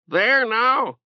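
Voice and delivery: high, insistent bird squawk; a pet bird insisting loudly; talking bird. Acted. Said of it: "Orb insisted".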